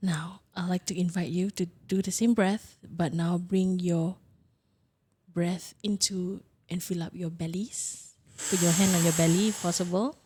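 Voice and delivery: whispered, soft breathing cues; guided meditation. Acted. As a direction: breathe the instructions out gently.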